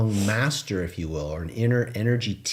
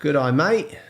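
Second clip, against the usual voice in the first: mock Aussie accent; 4 semitones higher than usual. Bantering with co-host, mock Australian accent. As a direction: play up the Aussie twang for a laugh. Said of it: "That was Aussie".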